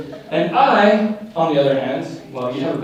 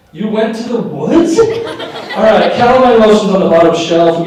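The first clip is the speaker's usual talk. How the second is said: higher, louder mock concern; mock alarmed; 6 semitones higher, 7 dB louder than usual. Brother fussing at sister about poison ivy, mock alarmed. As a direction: fuss over her like it's an emergency.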